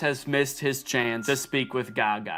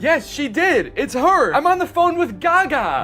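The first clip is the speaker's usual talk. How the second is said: higher, hammed-up thrill; mock excitement; 8 semitones higher, 4 dB louder than usual. Prankster pretends a star answered, excitedly. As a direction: pretend you are starstruck on a call.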